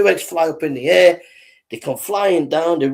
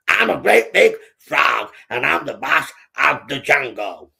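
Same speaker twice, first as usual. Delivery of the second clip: bullying big frog. Acted. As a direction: drag out each word as a cocky bully. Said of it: "He was a bit of a bully".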